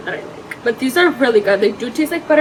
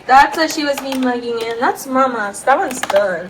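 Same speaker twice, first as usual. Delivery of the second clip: ordinary talk, nothing marked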